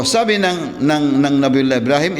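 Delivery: ordinary delivery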